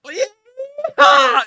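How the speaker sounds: high, loud whooping yell; yeehaw yell. Acted. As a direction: let out a wild cowboy yeehaw. Echoing the cheer, high cry.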